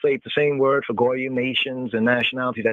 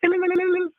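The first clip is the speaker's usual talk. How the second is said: high trilling ululation; faster than usual. Shown as a demonstration. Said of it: "አምላክ on the እልልታ".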